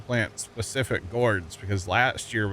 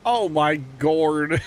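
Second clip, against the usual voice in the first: slow, loud exclamation; hammed-up horrified shout at a burning field; drawn-out shout; 5 semitones higher, 5 dB louder, much slower than usual. Shown as a demonstration. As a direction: belt it out and stretch every word. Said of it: "would I say".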